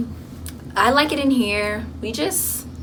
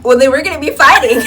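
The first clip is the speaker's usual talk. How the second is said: higher, louder mock retort; imitating friend's comeback; 5 semitones higher, 7 dB louder, faster than usual. Friend answering a fight warning, imitated cheeky comeback. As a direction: throw the threat back, playfully imitating her. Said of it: "She was like".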